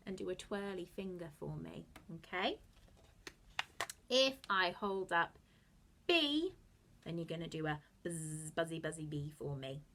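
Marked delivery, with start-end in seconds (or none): none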